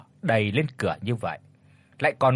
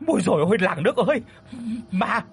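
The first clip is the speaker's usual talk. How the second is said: terrified cry; 9 semitones higher, faster than usual. Acted. Old man wakes screaming there is a ghost, terrified. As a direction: scream for help in terror.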